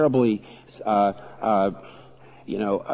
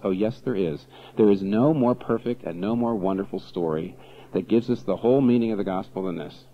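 normal talking voice

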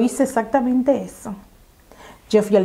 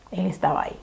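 near-whispered, much softer; hushed repeat; 4 semitones lower, 9 dB quieter than usual. Real, not acted. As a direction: drop to a whisper for suspense. (casi susurrado, con suspenso)

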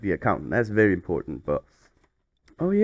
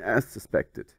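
usual speaking voice

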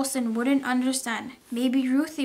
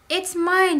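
higher, raised mock yell; 5 semitones higher, 4 dB louder than usual. Ruthie yelling at Martin before the teacher, yelling. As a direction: insist loudly like a child. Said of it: "she yelled".

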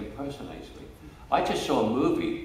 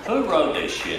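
loud, higher-pitched mock outburst; John Wayne impression; 9 semitones higher, 6 dB louder than usual. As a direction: imitate a film star breaking off his recital in disbelief. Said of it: "So he went".

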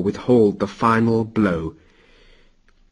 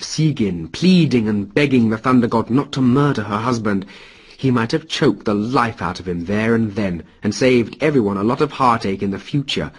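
ordinary delivery, nothing marked

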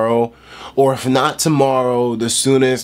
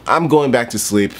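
ordinary talk, nothing marked

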